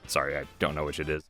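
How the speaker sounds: usual speaking voice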